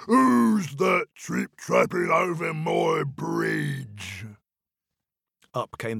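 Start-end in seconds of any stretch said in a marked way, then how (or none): 0.1-4.3 slow, drawn-out troll challenge; booming troll voice. Acted. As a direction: boom it out slowly. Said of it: "great and booming voice".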